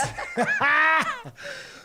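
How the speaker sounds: high, shouted burst of laughter; loud laugh burst. Real, not acted. Host bursting out after switching seats, loud laugh. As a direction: let out a big loud cackle.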